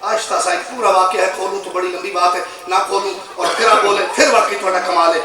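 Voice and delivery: ordinary delivery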